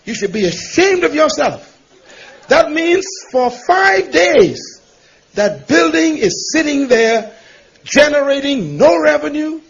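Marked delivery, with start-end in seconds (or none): 2.5-4.8 "five" drawn out slow and hammered; stress on five. Real, not acted. Preacher hammers "five" at the congregation, slow and heavy. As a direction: lean hard on "five". Stressing the building's idle days.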